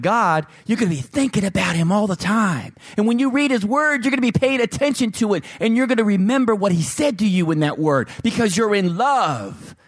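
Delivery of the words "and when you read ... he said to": lifted, urgent preaching; high fervor. Acted. Pastor urging listeners about the word, pitched high. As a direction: keep the pitch up and pushing.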